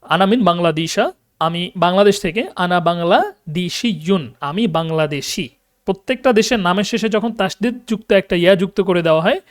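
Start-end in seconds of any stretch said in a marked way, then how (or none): 2.8-4.3 বাংলাদেশিয়ুন slowly, in separate syllables; syllable-by-syllable demonstration. Shown as a demonstration. Teacher modelling Arabic nationality word, slow syllables.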